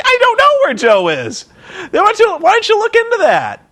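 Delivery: high-pitched incredulous retort; mock exasperation. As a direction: throw it back high and exasperated.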